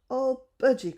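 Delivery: high, loud happy cry; a girl finding her lost budgie; excited cry. A narrator's reading. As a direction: cry out with joy at finding him. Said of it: "cried Bessie, excited".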